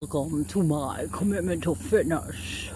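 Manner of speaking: lightly voiced creepy vampire; a hint of Dracula. Vlogger greeting viewers by flashlight, faint Dracula. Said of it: "a whole Dracula thing".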